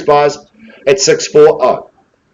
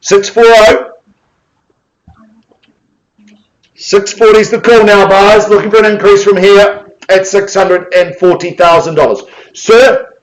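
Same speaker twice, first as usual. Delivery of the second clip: auctioneer's call; 7 semitones higher, 6 dB louder than usual. Acted. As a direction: call the bid and count it down, slowing on each call. Auctioneer calling for bids.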